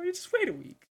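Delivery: squeaky high echo. Retold or imitated. Friend jokes about stalling the lunch lady, squeaky high. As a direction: squeak the line up high as a joke.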